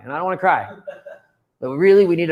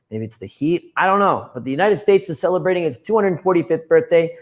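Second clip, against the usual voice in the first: usual speaking voice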